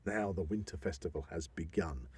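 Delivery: soft, half-whispered announcement; hushed proclamation. Retold or imitated. Announcing the start of the winter festival, soft and hushed. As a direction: hush it like opening a ritual. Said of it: "that moment on Beowulf Day to say".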